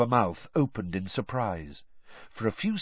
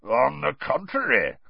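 growled contradiction; Moody; 3 semitones higher than usual. A narrator's reading. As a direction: growl back at him. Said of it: "growled Moody".

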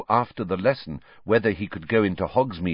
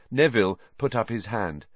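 ordinary delivery

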